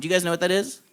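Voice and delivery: normal talking voice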